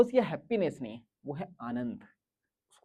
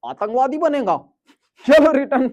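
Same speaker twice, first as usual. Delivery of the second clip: loud, higher scolding shout; scolding mother; 6 semitones higher, 10 dB louder than usual. Retold or imitated. Mother scolding her son at the fair, loud and sharp. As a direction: snap at the kid like an angry mom. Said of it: "उधर से mummy की आवाज आती".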